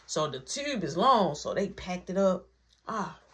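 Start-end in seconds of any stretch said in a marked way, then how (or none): none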